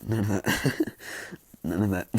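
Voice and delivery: breaking into chuckles mid-phrase; laughing. Real, not acted. Narrator rules out the makeout tactics, laughing. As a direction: repeat it through a laugh. Laughs at own joke about character.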